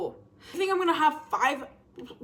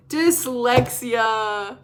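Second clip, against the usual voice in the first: drawn-out announcement; 7 dB louder, much slower than usual. Acted. Announcing the topic dramatically.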